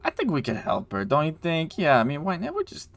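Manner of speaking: eager voiced helper, rising pitch; other guy. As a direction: pitch climbing as he persuades his partner. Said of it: "the other guy's like".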